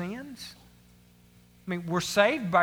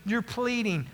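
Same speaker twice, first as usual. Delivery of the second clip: normal talking voice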